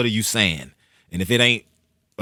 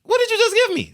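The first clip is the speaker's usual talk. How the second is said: much higher and louder, incredulous; incredulous high-pitched yelp; amazed disbelief; 8 dB louder than usual. Retold or imitated. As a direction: squeal it, blown away by the CD. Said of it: "I'm like".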